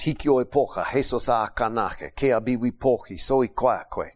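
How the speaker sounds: speaking the Esa Eja language of Peru. Shown as a demonstration. Preacher showing the congregation how the Esa Eja speak, calm demo. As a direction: recite the Esa Eja words as a native sample. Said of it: "they speak like this".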